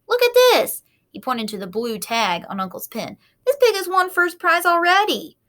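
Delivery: put-on high, loud excited boy; excited cry. A narrator's reading. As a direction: point it out excitedly. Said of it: "cried Avery".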